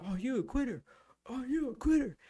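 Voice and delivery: high-pitched mock drill-instructor jeer; imitated instructor taunt. Instructor calling out a recruit who wants out, jeering.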